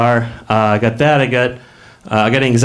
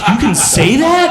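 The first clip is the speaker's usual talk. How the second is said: higher, slow, laughing "you can say that?"; stunned disbelief; 7 semitones higher, slower than usual. Retold or imitated. To himself at the bar after the confession, stunned and slow. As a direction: gasp it out, amazed.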